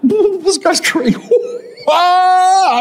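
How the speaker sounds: very high, squealed words bursting into a whoop; uncontrolled laughing, re-enacted. Husband mocking the preacher to his wife, laughing helplessly. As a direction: act out helpless hysterics, pitch shooting up. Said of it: "I just started laughing at him".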